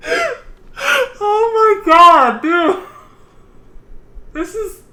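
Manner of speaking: very slow, high, wailing laugh; stretched-out helpless laughing; crying laughter. Real, not acted. Streamer overwhelmed by a pirate line, crying with laughter. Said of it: "this is fucking awesome".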